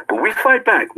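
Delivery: "fight" punched high and loud; stress on fight. Acted. Host drills his motto to the chat, stressing "fight". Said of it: "Remember, I used to say this before".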